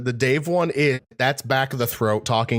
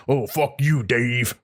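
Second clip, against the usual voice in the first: Cooper voice; slower than usual. Shown as a demonstration. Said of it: "if we're doing the classic thing".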